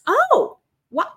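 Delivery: a realization that catches him off guard; sudden realization. Retold or imitated. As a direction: pitch jumps up on a startled oh what.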